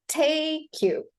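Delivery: slow "tay-kyou", K joined to "you"; connected words. Shown as a demonstration. Teacher shows how to connect the words, linked K. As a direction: draw out "take", linking it into "you". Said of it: "connect the words".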